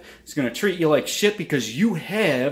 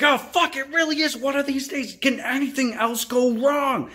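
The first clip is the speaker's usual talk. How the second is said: higher, exaggerated fed-up whine; mock exasperation; 10 semitones higher than usual. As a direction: overplay the coffee disaster. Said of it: "You're like".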